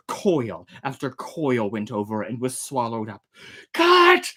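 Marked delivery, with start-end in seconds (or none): 3.7-4.3 high, loud urgent shout. A narrator's reading. Ordering the crew to cut the line, loud high shout. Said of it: "I shouted".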